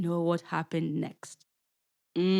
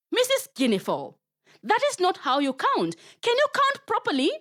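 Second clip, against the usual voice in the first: indignant spider; 11 semitones higher than usual. Acted. Anansi objects to counting.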